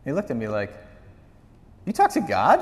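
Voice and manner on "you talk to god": high, louder mock amazement; pitched-up disbelief; astonished. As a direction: act out an awestruck kid's question. Boy amazed pastor hears God.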